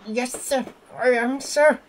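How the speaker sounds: high, muffled little reply. A narrator's reading. Percy replying to the fat controller, muffled. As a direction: answer meekly, high and muffled. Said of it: "a muffled voice replied".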